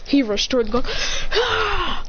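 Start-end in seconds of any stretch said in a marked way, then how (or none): 1.3-2.0 very high charging yell, volume held back; mock battle cry. Rushing attack battle cry.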